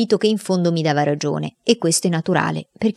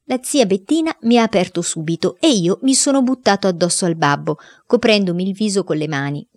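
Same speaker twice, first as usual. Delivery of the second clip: plain reading voice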